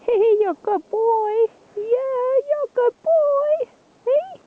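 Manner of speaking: high, sing-song praise for the dog; high pet-talk. Real, not acted.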